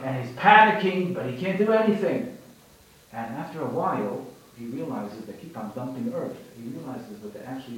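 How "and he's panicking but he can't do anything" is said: loud, rising panic in the telling; panicking donkey. A narrator's reading. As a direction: tell it like the donkey is losing it. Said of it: "heeing and braying and screaming".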